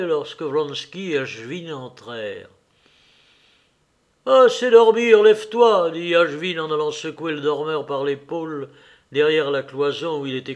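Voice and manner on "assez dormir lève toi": loud, high wake-up order; brisk, commanding call to get up; rousing shout. Acted. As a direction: rouse a heavy sleeper. Rousing a sleeping comrade.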